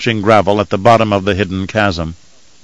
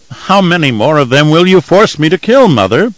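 plaintive; 6 semitones higher, 4 dB louder than usual. A narrator's reading. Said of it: "he inquired, almost plaintively".

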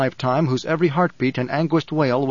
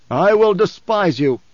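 loud, slow, higher-pitched command; 5 semitones higher, 6 dB louder, slower than usual. A narrator's reading. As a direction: hurl it out as an irresistible threat. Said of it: "carried all the old overtones of voice".

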